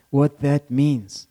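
ordinary talk, nothing marked

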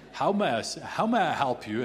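loud, formal British butler voice; comic British butler offering help. Acted. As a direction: put on a British butler's accent. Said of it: "inevitably with a British accent".

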